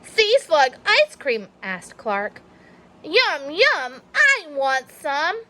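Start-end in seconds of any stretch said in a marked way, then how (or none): 0.0-1.5 eager question. Acted. Clark asks excitedly. 3.0-5.4 greedy yum. Acted. Clark wants ice cream.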